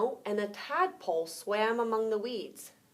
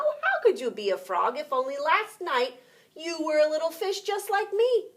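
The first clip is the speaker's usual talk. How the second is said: higher, louder indignant protest; raised indignant voice; arguing; 6 semitones higher, 6 dB louder than usual. Acted. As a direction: push back in disbelief. Minnow argues with tadpole.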